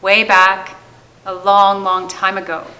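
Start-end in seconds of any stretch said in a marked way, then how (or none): none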